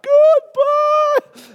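very high, loud drawn-out cry; comic fleeing yell. Retold or imitated. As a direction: yell a hammed-up farewell while bolting. Boy flees the scene yelling.

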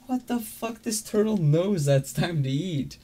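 high, slow, stunned voice; baffled disbelief. Retold or imitated. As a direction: gasp it out in total disbelief. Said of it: "You're like".

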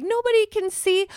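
mock carefree. Her past self shaving by the window, naively carefree. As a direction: play the oblivious self who thinks she's hidden. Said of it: "and you're like".

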